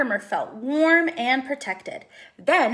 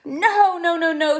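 high, loud squeaky protest; high-pitched louder squeak; 6 semitones higher, 8 dB louder than usual. Acted. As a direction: squeak out the nos in panic. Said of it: "squeaked Mortimer".

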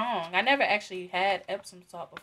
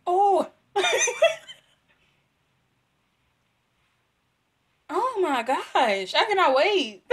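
excited; 9 semitones higher, 5 dB louder, slower than usual. Real, not acted. Thrilled by shampoo smell.

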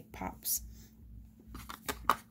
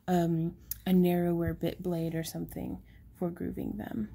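usual speaking voice

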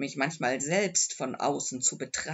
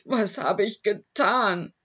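loud, higher anguished outcry; bursting into tears; 4 semitones higher, 10 dB louder than usual. A narrator's reading. Owner realising what he did to his dog, anguished outcry. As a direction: cry out in sudden remorse. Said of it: "brach in Tränen aus".

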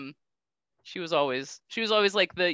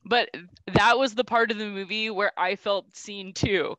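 normal talking voice

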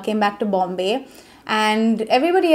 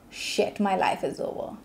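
hushed, whispered private despair; 8 dB quieter than usual. Retold or imitated. Whispering her crushed thoughts after losing the pageant. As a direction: breathe it out like a secret.

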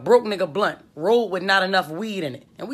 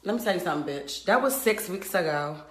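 ordinary talk, nothing marked

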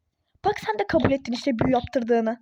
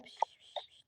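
hushing shh; 18 dB quieter, slower than usual. Real, not acted. Mother hushing her crying baby, soft shushing.

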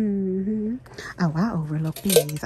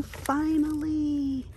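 breathless relief; 10 semitones higher, much slower than usual. Real, not acted. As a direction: let it melt out of you. Finds the sign she wanted.

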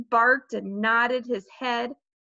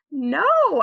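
loud, high, stubborn no; emphatic refusal; 5 semitones higher, 7 dB louder than usual. A narrator's reading. Peter refusing his mother's request, loud and emphatic. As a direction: refuse with a sudden raised voice.

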